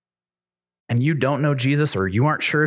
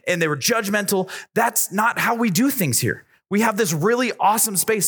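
higher, rousing sermon voice; preaching; 6 semitones higher than usual. Acted. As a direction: fire up the youth group. Preaches to youth crowd.

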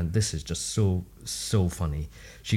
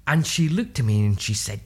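ordinary delivery

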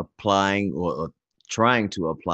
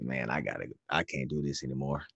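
low, tired sigh of a line; weary and low; 4 semitones lower, 9 dB quieter than usual. Retold or imitated. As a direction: sound fed up and worn out. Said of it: "I said".

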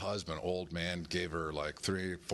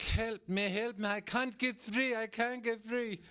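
high, slow helpless cry for help; mock damsel in distress; 13 semitones higher than usual. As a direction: ham up a bad actress begging to be freed. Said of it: "she's like".

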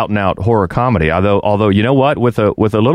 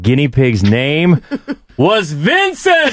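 slow, laughing through the words; 6 semitones higher, slower than usual. Real, not acted. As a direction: break into laughter on the name.

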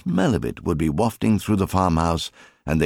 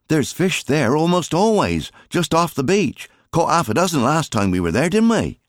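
put-on higher, louder boy's voice; a higher, louder keen young son; eager son; 11 semitones higher, 4 dB louder than usual. A narrator's reading. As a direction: urge your father on eagerly. Son Alfie voiced in story.

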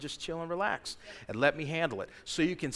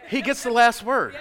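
ringing proclamation; 7 semitones higher, 8 dB louder than usual. Real, not acted. Repeated proclamation, building.

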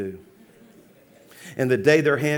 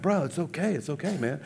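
quietly calm someone who is making a scene; hushed soothing; 8 dB quieter than usual. Retold or imitated. Passenger trying to quiet his crying seatmate, hushed. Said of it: "I'm trying to quiet him down".

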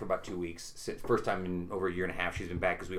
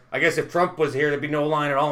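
loud; 4 semitones higher, 7 dB louder than usual. Retold or imitated. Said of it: "He's like".

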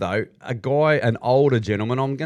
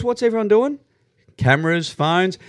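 usual speaking voice

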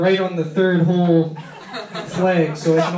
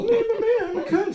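much higher, laughing mock old lady voice; mock old wife. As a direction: squeak it out like a batty old wife. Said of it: "his wife was all".

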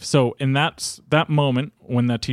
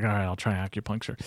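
grudging agreement; 4 semitones lower, 8 dB quieter, faster than usual. Retold or imitated. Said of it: "And I was like".

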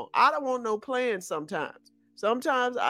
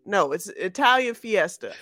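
ordinary talk, nothing marked

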